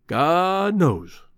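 a weary, sorrowful sigh of a line; sad. A narrator's reading. Old cowboy sighing sadly to his citified friend. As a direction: let it sink, mournful and resigned. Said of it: "said Greenbrier sadly".

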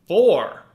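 "four" punched high and loud, then a pause; emphatic stress. Real, not acted. Host stresses how many officers died. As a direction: hit "four" hard to make it land.

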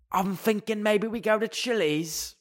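high, jokey put-on British accent. Friend nervously suggesting Chili's to the group, joke British accent. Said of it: "start talking in a British accent".

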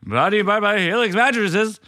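high-pitched, put-on radio voice. Shown as a demonstration. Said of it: "take away my radio voice".

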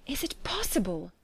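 hushed disbelief; soft, amazed question; astonished cry. A narrator's reading. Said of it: "cried Elizabeth".

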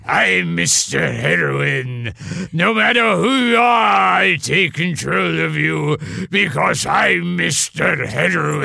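loom over them like a drug-peddling villain; Mister Heroin. Retold or imitated. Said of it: "A little Sidney Greenstreet and Mister Heroin".

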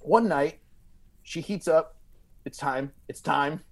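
usual speaking voice

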